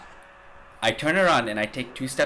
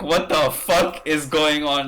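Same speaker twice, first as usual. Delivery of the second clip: slow, louder, drawn-out shock; stunned disbelief; 5 semitones higher, 7 dB louder, slower than usual. Retold or imitated. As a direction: let the disbelief stretch out. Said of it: "I was like".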